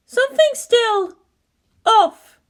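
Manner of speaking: worried officer, drawn out; Misato's voice. Acted.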